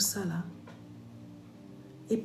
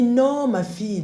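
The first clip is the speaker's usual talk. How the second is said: mother's emphatic no; 8 semitones higher, 6 dB louder, slower than usual. Retold or imitated. Mother reassures her.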